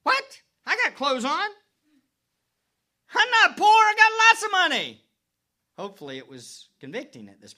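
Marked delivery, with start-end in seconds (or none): 0.0-5.1 high, loud, offended protest; indignant church. Acted. Laodicean church reacting to the letter, loudly offended. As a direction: scoff like a church insulted by the letter. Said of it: "when they read this letter".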